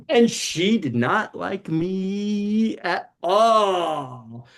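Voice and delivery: ordinary talk, nothing marked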